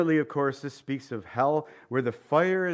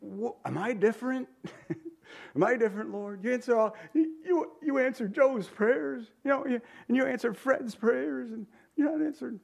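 much higher, whining complaint; whiny believer; 9 semitones higher than usual. Pretend. Preacher voicing a jealous believer whining to God, much higher. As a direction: whine like a jealous kid. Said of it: "We feel like".

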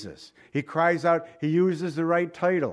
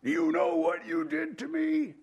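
hammed-up indignant accusation; mock prideful demand; 12 semitones higher, 4 dB quieter than usual. Proud person crying out to the Lord, indignant. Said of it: "in pride".

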